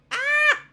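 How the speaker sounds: sudden high, loud yelp of surprise; a sharp surprised squeal; startled shriek. Real, not acted. Startled while hunting for a button.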